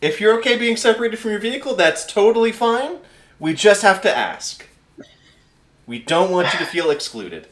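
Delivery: high-pitched, over-considerate bureaucrat; high, careful voice of a pencil-pusher; pencil-pushing clipboard official. Acted. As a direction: reassure like a painfully accommodating official. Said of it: "pencil-pushing motherfucker with a clipboard".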